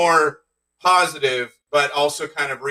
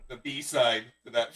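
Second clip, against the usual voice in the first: ordinary delivery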